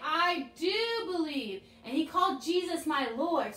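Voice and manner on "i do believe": slow call-and-response as Thomas. Shown as a demonstration. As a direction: DO leaned on. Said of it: "Shake your head, say".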